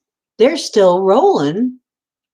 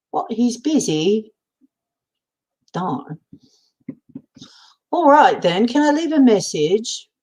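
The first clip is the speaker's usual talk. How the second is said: put-on higher-pitched, playful British accent; 6 semitones higher than usual. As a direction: pretend phone caller with a British accent. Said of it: "I'm doing the British accent".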